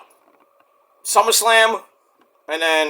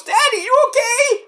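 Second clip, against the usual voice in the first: put-on much higher small-child voice asking; little girl. Young Noelle asking her hurt daddy, high and childlike. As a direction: play a worried little girl. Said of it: "Noelle comes".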